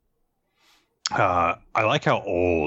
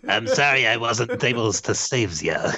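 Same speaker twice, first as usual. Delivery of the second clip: cartoon sailor impression; hammed-up comic sailor voice; Popeye. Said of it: "voice her as Popeye".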